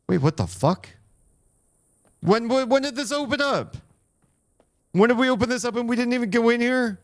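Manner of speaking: very high, loud disbelieving cry; real astonished shriek. Real, not acted. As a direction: shriek at the sudden discovery. Discovers hidden passage in game.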